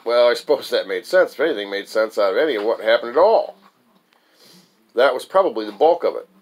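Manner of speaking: usual speaking voice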